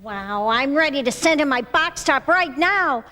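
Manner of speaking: ordinary delivery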